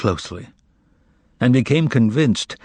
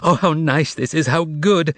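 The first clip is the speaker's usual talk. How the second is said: higher joyful exclamation; cried out gladly; 5 semitones higher than usual. Acted. Margarita greeting Azazello's arrival, joyfully crying out. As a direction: burst out with delight. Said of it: "cried out".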